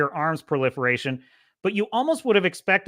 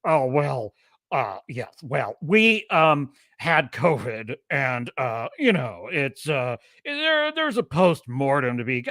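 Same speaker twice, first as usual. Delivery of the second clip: mock Bob Iger; 4 semitones lower, slower than usual. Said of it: "when I listen to Bob Iger".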